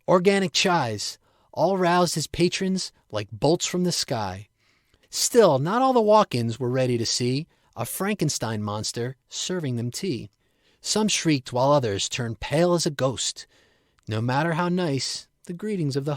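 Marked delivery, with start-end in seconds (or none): none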